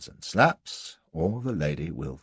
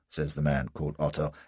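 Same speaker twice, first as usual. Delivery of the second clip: usual speaking voice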